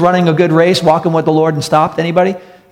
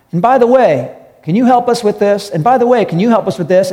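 higher-pitched, lightly acted request; calling out a request; 6 semitones higher than usual. Preacher as official asking freed Joseph for help, calling out a request. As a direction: call it over, pitched up.

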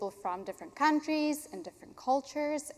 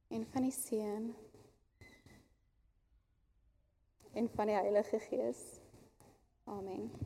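quiet, tender Afrikaans baptism formula; hushed baptism; 6 dB quieter than usual. Real, not acted. Baptizing her son in Afrikaans.

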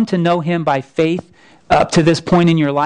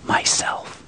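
a barely audible whisper, much softer; 9 dB quieter than usual. Acted. The lion names himself a third time, whispered. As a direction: breathe it like rustling leaves. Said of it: "whispered so softly you could hardly hear it".

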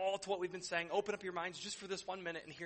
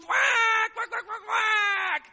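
very high, loud, slow shouted quacks; ecstatic duck quacks; 15 dB louder, much slower than usual. Acted. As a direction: shout the quacks like thrilled ducks. Moved ducks quack loudly.

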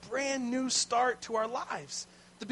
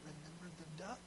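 soft, sly whisper in his ear; 4 semitones lower, 19 dB quieter than usual. Retold or imitated. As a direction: hiss the reminder close to his ear. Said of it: "she whispered really closely in his ear".

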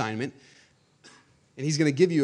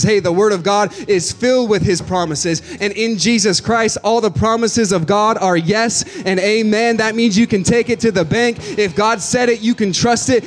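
louder, higher, slowing to hammer each line; rising pulpit fervour; preaching at full force; 6 semitones higher, 6 dB louder than usual. Acted. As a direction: preach it to the back row.

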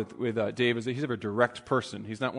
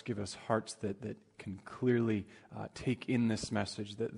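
hushed, slow prayer voice; 2 semitones lower, 5 dB quieter than usual. Acted. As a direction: pray softly before the sermon. Closing a prayer.

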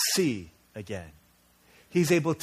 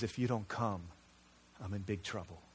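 quiet, pleading, softer and lower; desperate cry; 4 semitones lower, 8 dB quieter than usual. Shown as a demonstration. Child showing a cry of real desperation, quiet plea. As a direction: plead like you're truly in trouble. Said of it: "a cry of desperation".

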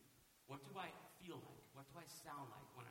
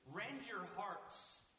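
slow, loud and higher, each word hammered; emphatic command; 5 semitones higher, 5 dB louder, much slower than usual. Acted. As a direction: proclaim it like God's command. Preacher booms God's command.

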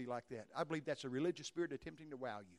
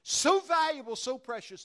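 much higher and louder, each word pushed; swelling emphasis; 9 semitones higher, 12 dB louder than usual. Acted. Preacher marvelling at how precious people are to God, swelling. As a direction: lift the congregation with these words.

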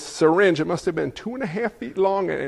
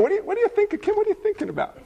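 mock nagging question; 11 semitones higher, 3 dB quieter, much faster than usual. Pestering question to congregant Kim.

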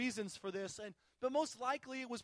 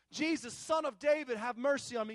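imitated shouting; 5 dB louder than usual. Beggar shouting over and over to Jesus, imitated shout. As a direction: repeat the beggar's plea as a shout. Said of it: "He's shouting it over and over".

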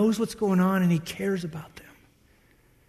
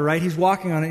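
ordinary talk

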